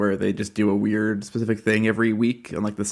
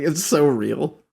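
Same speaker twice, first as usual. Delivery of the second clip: ordinary talk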